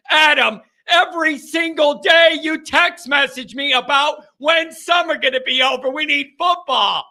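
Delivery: loud, high excited rant at a listener; fired-up yelling, real; worked-up shout. Real, not acted. Host yelling at a texting listener, worked up. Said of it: "I'm worked up".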